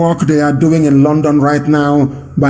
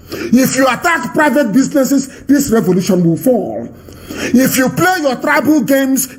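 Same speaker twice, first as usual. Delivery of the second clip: higher, shouted warnings, hammering each threat; preaching at full force; 6 semitones higher than usual. Acted. Broadcaster warning protesters against attacking banks, fiery preaching. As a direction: thunder at them like a furious preacher.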